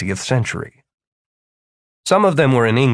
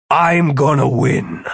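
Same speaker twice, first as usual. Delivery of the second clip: slow, drawn-out vow to win; grimly resolute wizard, drawn out; determined Philip; 2 semitones higher, slower than usual. A narrator's reading. Philip to Martin on the plan, determined.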